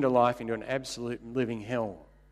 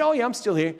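cheery reply; 10 semitones higher, 5 dB louder than usual. Retold or imitated. Cheery reply to spent caller.

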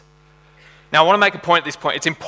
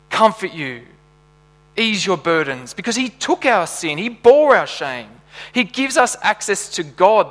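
higher-pitched, emphatic sermon delivery; impassioned preaching; 5 semitones higher, 5 dB louder than usual. Real, not acted. Preacher urging the church toward true comfort, impassioned preaching. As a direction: drive it home to the congregation with rising conviction.

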